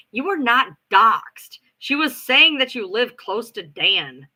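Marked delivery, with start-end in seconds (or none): 0.1-1.6 slow, firm, louder put-down; slow flat correction. Retold or imitated. The friend firmly correcting the panicking rival, slow. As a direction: spell it out like to a child. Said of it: "she's like".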